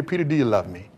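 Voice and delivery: soft, low gentle question; hushed tender Jesus. Acted. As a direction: speak softly as Jesus restoring Peter. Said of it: "Jesus came back and said to Peter".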